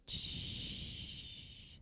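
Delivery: soft whispered shh; whispered shush. Real, not acted. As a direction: hush the room in a whisper. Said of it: "can you be really quiet".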